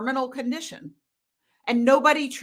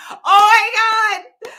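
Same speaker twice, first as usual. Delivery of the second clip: loud, high burst of delight; real squeal of laughing delight; delighted outburst; 9 semitones higher, 9 dB louder, slower than usual. Real, not acted. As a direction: shriek it out, cracking up. Reacting to a funny comment.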